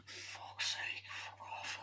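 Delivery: hushed, breathy swearing under the breath; exasperated whispered curse; annoyed whisper. Retold or imitated. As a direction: whisper it to yourself in disgust. Muttering his own annoyed reaction.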